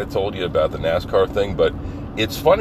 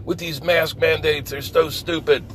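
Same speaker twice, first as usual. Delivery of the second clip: angry anti-masker; 13 semitones higher than usual. Retold or imitated. Mocking the angry woman's rant about mask mandates, high whiny voice. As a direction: mimic an indignant anti-mask complainer. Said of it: "Just, just angry, being angry".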